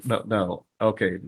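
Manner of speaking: normal talking voice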